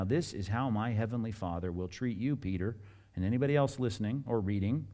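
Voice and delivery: ordinary talk, nothing marked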